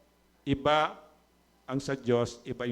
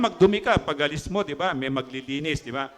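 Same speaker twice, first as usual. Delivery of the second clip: ordinary talk, nothing marked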